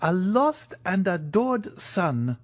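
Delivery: slow, high-pitched heartfelt echo; emotional old major. Acted. Major echoes news of his lost son, emotional. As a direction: draw it out high with longing.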